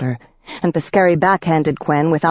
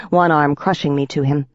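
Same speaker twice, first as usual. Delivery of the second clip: usual speaking voice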